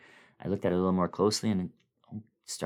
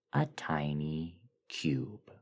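eerie whisper; slower than usual. Acted. Dreamer finding the cube in the desert, slow whisper. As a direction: let it out as an awed whisper.

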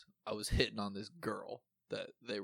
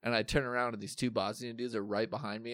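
ordinary delivery

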